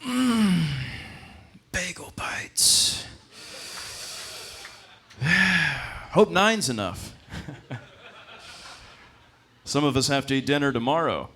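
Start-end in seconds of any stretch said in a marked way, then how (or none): 0.0-7.1 sultry host. Acted. Peeking in oven, sultry.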